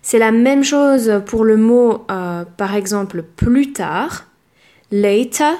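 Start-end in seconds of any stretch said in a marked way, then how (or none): 4.9-5.5 British later; "Later" with a clear T and no final R; British English. Shown as a demonstration. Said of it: "la différence avec le T". (en anglais britannique, "later" avec un T net, sans R)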